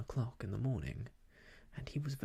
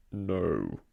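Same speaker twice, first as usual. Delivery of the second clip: much lower put-on voice, a touch louder; disguised voice; 7 semitones lower, 7 dB louder than usual. Rabbit hiding from Pooh, low disguised voice. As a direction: Rabbit fakes a stranger's voice, low. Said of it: "in a very different sort of voice".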